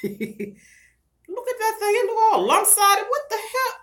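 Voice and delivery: high, laughing, can't believe how the hair looks; laughing disbelief. Real, not acted. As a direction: giggle through the words at the mess. Laughing at her lopsided hair.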